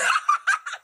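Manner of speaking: a high, loud burst of laughter; loud amused laugh; shrieking laugh. Real, not acted. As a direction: cackle at her own joke. After her own joke.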